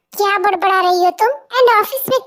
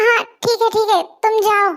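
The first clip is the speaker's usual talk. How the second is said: ordinary talk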